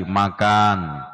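usual speaking voice

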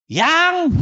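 high, sharp-rising call to a spouse. Shown as a demonstration. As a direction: call your sweetheart with the pitch pushed right up. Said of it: "Tapi intonasinya dinaikkan".

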